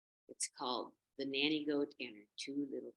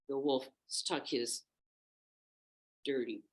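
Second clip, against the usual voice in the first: ordinary delivery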